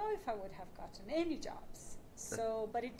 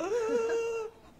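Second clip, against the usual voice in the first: loud, high panicked gasp; sharp gasp; 12 semitones higher, 7 dB louder than usual. Retold or imitated. A journalist recalls being told her degree meant nothing, gasping. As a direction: gasp in mock despair at the rejection. Panicked after a harsh job interview.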